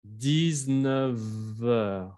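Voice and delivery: slow "Dix-neuf heures" with the f turned into a v linking into heures; teacher drawing out the linked v sound for students; liaison shown. Shown as a demonstration. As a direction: say "Dix-neuf heures" slowly as diz-neu-veur. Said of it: "Ahí se hace la liaison".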